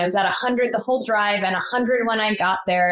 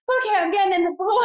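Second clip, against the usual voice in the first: high, quick and urgent; urgent resolve; 10 semitones higher, faster than usual. Retold or imitated. Mother in a contraction deciding on the pool, high and quick. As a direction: blurt it out, suddenly decided. Sudden decision during contraction.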